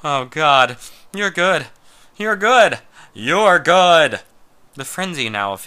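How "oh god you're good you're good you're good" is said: loud ecstatic cries, rising high; building shout of pleasure; crying out. Acted. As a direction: cry out in rapture, each time louder. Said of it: "he cried out".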